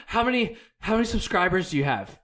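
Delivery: a touch of a starstruck stranger; imitating eager curiosity. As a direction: play her pushy excitement, lightly. Lady asks his subscriber count.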